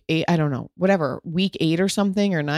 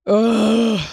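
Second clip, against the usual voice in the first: 1 emotional groan; 6 semitones higher, 6 dB louder than usual. Real, not acted. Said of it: "I get emotional".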